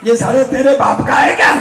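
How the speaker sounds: loud mock-indignant retort; a comic outraged yell; retort shout. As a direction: shout the punchline at him. Punchline retort to Englishman.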